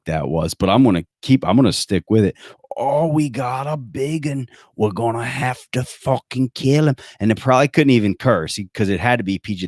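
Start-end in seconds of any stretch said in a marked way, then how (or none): 2.8-7.0 half-hearted Australian action hero; Australian accent. Retold or imitated. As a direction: drag out "Oh, we got a big un" in mock Australian. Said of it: "Was that Australian?".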